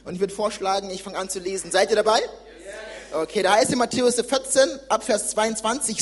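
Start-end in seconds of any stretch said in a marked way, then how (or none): none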